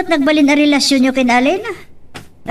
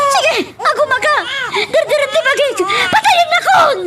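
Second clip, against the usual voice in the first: high, raw screaming through tears; crying shout; 10 semitones higher than usual. Acted. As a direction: scream it at him, breaking. Heated quarrel, crying shouts.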